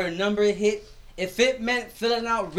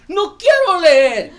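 put-on high, loud, slow kid's whine with a laugh breaking through; whiny child refusal; 10 semitones higher, 12 dB louder, slower than usual. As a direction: pout out the refusal like a stubborn little boy.

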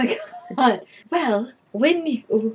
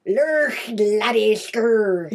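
lightly done Swedish chef gibberish; mock Swedish; much slower than usual. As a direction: babble like the Swedish chef, half-hearted. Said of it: "That was way too Swedish".

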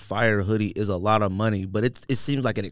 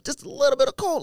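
ordinary delivery

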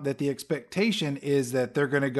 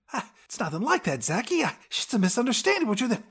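sleazy Phil excuse; 9 semitones higher, faster than usual. Retold or imitated.

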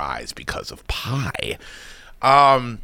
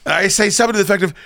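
usual speaking voice

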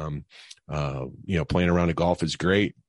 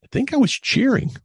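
high, loud squeaky protest; dad squeaks out a defence; sheepish squeak; 6 dB louder than usual. Retold or imitated. Dad answers his young son in a high squeaky defensive voice. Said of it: "And I go".

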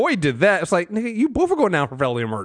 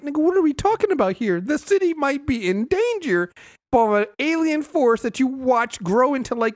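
incredulous rant; 8 semitones higher than usual. Retold or imitated. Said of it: "I'm like".

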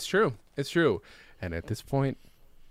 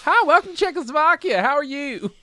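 very high, loud Czechoslovakian greeting; mock Czechoslovakian accent; 12 semitones higher, 6 dB louder than usual. Greeter welcoming visitors to Czechoslovakia, hammed-up accent. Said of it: "It's either that or Czechoslovakia".

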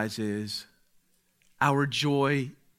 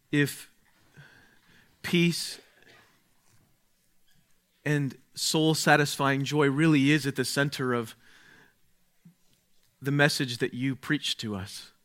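prayer. Acted. Leads a prayer.

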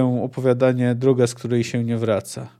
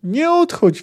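put-on much higher thin childlike scream; 14 semitones higher, 3 dB louder than usual. Ciri screams thinly at Geralt riding off, high shriek. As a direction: shriek it thinly like a small girl. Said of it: "wrzasnęła cieńko".

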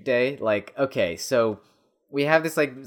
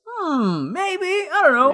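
mock pondering; 5 dB louder, slower than usual. Mock doubtful musing aside.

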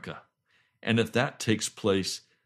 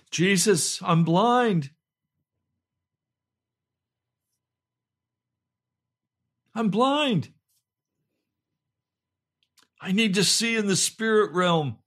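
high, pleading cries in prayer; crying out; 8 semitones higher, 5 dB louder than usual. Retold or imitated. Blind man's plea to the Lord Jesus, crying out. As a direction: call out in desperate need. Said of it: "a loud voice crying out to the Lord".

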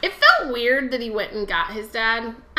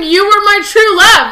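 high, very loud melodramatic declaration; hammed-up lovelorn yell; yelled mock quote; 10 semitones higher, 14 dB louder than usual. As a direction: shout the quote to win the argument. Yelling a quote to win the argument.

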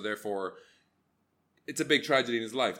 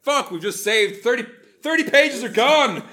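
high, loud, slowing triumphant cry; mock writers' room excitement; 10 semitones higher, 11 dB louder than usual. Imagined writer celebrating the cut, loud and high. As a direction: shout it like you just cut thirty pages. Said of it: "It's like".

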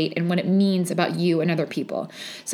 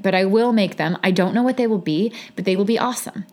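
normal talking voice

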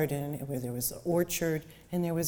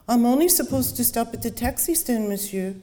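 higher, louder driver objecting; a lightly voiced Paris cabbie; taxi driver voice; 6 semitones higher, 4 dB louder than usual.

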